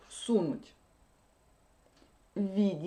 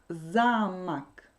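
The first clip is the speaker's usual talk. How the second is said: "замок" with a long, stressed first syllable; "замок", slow, accent at the start; 2 semitones lower, 4 dB louder than usual. Shown as a demonstration. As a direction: stretch the "за" in "замок", let "мок" drop. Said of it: "Si l'accent tombe sur la première syllabe".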